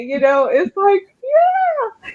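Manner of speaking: fighting back real tears; choking up. Real, not acted. [name] overcome thinking of her students' thanks, voice breaking. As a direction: voice cracking up high on yeah. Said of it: "I'm not gonna cry anymore".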